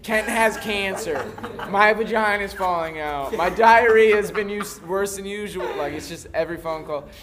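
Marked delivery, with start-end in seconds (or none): 0.0-5.7 put-on high, loud old lady rant. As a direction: rattle it off like a chatty granny. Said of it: "it's always just like".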